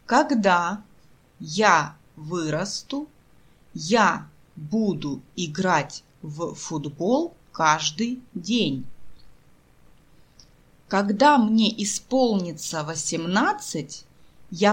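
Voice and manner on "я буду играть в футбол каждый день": normal talking voice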